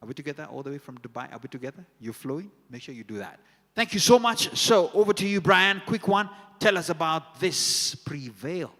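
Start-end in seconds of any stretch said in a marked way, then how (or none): 3.8-8.7 high, loud announcer energy over the music; hyped MC handover. Real, not acted. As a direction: build the hype as you pass the mic. MC hands over to Brian.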